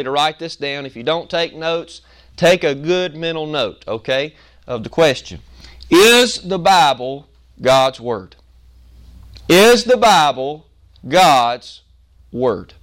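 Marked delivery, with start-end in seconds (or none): 5.9-12.7 slow, each word punched: Is the Bible God's Word; slow and weighty, word by word; every word stressed. Shown as a demonstration. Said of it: "The reason why I emphasize every word".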